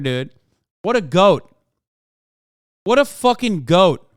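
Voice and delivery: ordinary talk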